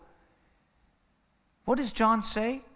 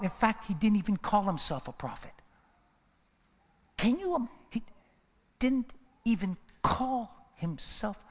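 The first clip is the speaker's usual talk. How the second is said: usual speaking voice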